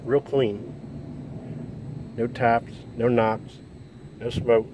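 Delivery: talking around a cigar; words round a cigar in the mouth. Real, not acted. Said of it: "Sorry, I have a cigar in my mouth".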